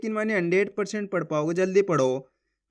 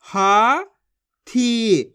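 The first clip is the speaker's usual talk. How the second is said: हाथी drawn out slowly, each syllable separated and the long vowels held; 4 dB louder than usual. Shown as a demonstration. Teacher sounding out हाथी for young readers, slow and separated. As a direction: sound out हाथी letter by letter, stretching हा and थी. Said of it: "एक ही अक्षर का उच्चारण करके पढ़ रहा हूँ".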